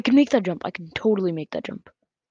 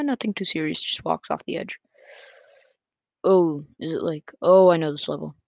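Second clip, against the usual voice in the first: ordinary talk, nothing marked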